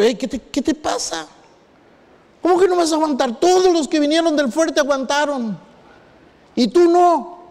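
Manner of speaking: high, rising incredulous scold from the director; a stern director, lightly voiced, scolding a homesick boy; imagined scolding.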